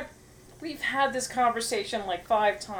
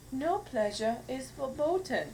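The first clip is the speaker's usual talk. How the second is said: higher, slow mock German accent; 4 semitones higher, 3 dB quieter than usual. Shown as a demonstration. Showing the ad is funnier with the accent, German accent. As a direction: say it slowly as a cheesy German. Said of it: "didn't have a German accent".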